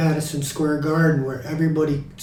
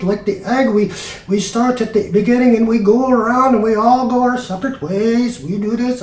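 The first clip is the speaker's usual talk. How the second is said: raised, rambling rhapsody in imitation; gushing bandmate; 6 semitones higher than usual. Bandmate muses to John on eggs, hammed-up gushing. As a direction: ramble on in wonder, pitched a little high. Voices a band member in the reunion bit.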